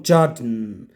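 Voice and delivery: "Jordan" with a reduced ending, JOR-dn; correct pronunciation. Shown as a demonstration. Teacher corrects the students, "Jordan" as JOR-dn. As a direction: swallow the vowel before the n in "Jordan". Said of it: "So the pronounce is Jordan".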